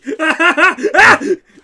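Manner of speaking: big loud cackle; howling laugh; loud burst of laughter. Real, not acted. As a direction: crack up hard.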